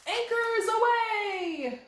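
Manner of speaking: high, slow sea-captain call; high drawn-out sailing cry; cheerful sailor call. Acted. Setting sail in pretend boat.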